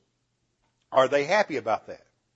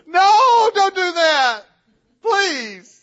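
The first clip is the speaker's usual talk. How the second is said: very high, loud, desperate demon plea; begging demons; 10 dB louder, much slower than usual. Acted. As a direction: cry out no in panic, pitched way up.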